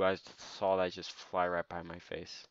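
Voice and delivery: ordinary talk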